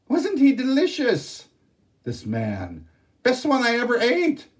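high, loud mock bear voice; a hammed-up shouting bear; growly bear shout. Day Day pretending to be a bear to fool the sisters, growly shout. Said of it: "shouted in a growly voice".

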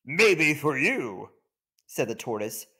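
outdo the warthog with dry pride; smug tortoise. Acted. Tortoise outbids.

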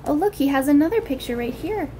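usual speaking voice

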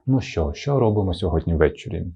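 ordinary talk

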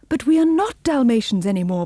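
high, loud protest; crying out. A narrator's reading. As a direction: cry out in distressed objection. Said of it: "cried missus".

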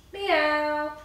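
put-on high playful meow for the kids; cat meow. Shown as a demonstration. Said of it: "you can even make the cat noise".

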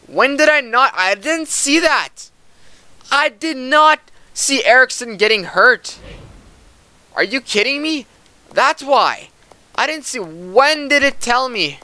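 high, loud disbelief; can't believe he missed it; shocked outburst. Real, not acted. Player misses injured teammate.

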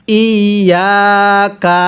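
very slow, drawn-out ইয়াকা; model recitation. Shown as a demonstration. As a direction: hold the doubled ya in ইয়াকা on a level tone. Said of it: "পড়ুন আমার সাথে".

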